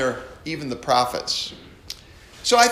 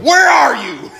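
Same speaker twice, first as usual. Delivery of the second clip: high, loud thundering demand; mock minister shouting God's words; booming God; 9 semitones higher, 9 dB louder than usual. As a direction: roar it like an angry God. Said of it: "he gets to God coming to Adam".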